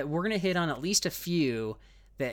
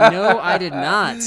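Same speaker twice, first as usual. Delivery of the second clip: loud, drawn-out, higher-pitched protest; emphatic denial; 5 semitones higher, 13 dB louder, slower than usual. Real, not acted. Host protesting co-host's accusation of lying, loud emphatic denial. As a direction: deny it loudly, stretching each word. Teased about lying, denies it.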